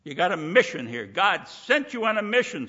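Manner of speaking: ordinary delivery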